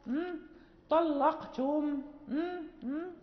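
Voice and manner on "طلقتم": طلقتم drawn out with a thick lam; slow heavy lam. Shown as a demonstration. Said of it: "نغلظ".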